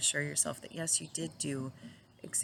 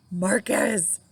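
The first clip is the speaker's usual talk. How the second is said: scolding Marcus; 5 semitones higher, 8 dB louder than usual. Retold or imitated. Wife scolding husband in story.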